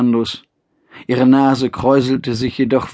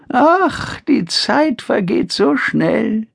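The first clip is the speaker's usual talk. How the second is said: put-on frail old mother; 11 semitones higher, much slower than usual. Old mother speaks fondly.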